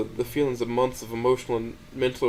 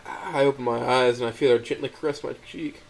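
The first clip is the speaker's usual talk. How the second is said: plain reading voice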